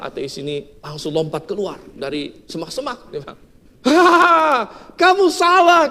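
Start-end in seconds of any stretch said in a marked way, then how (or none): 3.8-5.9 high, loud triumphant taunt; gloating shout. Retold or imitated. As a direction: jump out and crow at her. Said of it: "katanya, ya". (teriakan ejekan yang keras dan tinggi)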